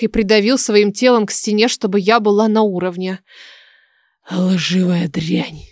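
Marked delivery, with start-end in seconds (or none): 4.3-5.6 low, drawn-out menacing hiss; angry hiss. A narrator's reading. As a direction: hiss it slowly, under the voice. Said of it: "Прошипел он мне прямо в лицо". (злобное протяжное шипение)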